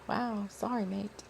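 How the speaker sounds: very soft, tentative European accent; European accent attempt. Shown as a demonstration. Narrator tests Dakar's voice, tentative European accent. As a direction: quietly try out a European accent. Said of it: "what would his accent sound like".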